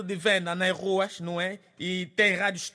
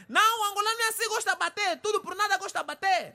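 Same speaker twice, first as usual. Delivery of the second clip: high-pitched insistence; 9 semitones higher, faster than usual. Acted. Raised pitch comment.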